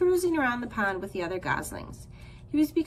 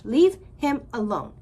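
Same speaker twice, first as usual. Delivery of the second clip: angry command; 7 dB louder than usual. Acted. Goose attacks the fish.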